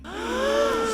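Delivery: sharp, high mock gasp. Real, not acted. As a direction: gasp like you just caught something. Reacting to a rumour on video.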